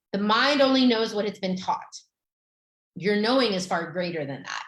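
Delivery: normal talking voice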